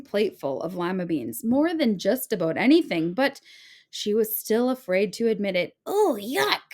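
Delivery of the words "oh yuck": much higher, put-on yuck; fake disgust. A narrator's reading. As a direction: pretend to hate it. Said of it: "she said".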